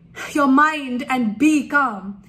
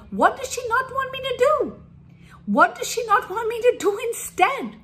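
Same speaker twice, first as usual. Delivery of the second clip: put-on much higher puzzled kid's voice; confused child; 10 semitones higher than usual. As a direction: wonder aloud like a bewildered little child. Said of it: "the child will really wonder".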